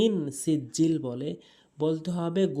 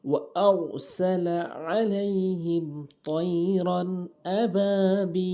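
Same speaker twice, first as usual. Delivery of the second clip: Quran recitation; 3 semitones higher, much slower than usual. Shown as a demonstration. Recites the verse.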